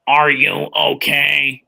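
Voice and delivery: slow robotic monotone of a voice box; electrolarynx voice. Retold or imitated. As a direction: imitate a man speaking through a throat device. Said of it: "it's one of those voice things".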